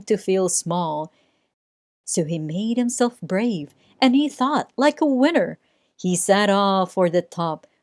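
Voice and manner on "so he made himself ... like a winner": ordinary delivery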